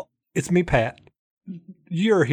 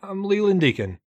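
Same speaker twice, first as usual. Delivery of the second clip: lightly raised car-dealer pitch, upbeat; mock announcer hype, a touch higher; game show host; 7 semitones higher than usual. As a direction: sell it like a local TV car lot ad. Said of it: "sound like it's your alias".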